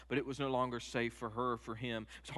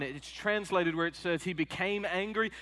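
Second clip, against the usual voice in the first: ordinary talk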